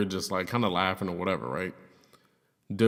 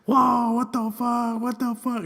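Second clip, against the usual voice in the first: high, drawn-out exclamations; mock shocked crowd; 12 semitones higher, much slower than usual. Friends react to a sudden kiss, drawn-out mock shock. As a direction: stretch every word out in high-pitched disbelief. Imitating everybody's stunned reaction.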